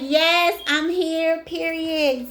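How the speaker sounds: high, loud, sassy declaration; sassy quote. Retold or imitated. Card figure voiced as declaring her presence, high and sassy. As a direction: announce yourself with attitude. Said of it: "She was like".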